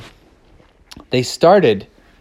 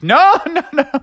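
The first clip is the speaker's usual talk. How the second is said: laughing reassurance; 13 semitones higher than usual. Acted. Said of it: "Bubby was like".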